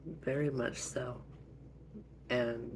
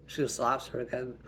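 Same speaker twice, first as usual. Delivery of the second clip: ordinary talk, nothing marked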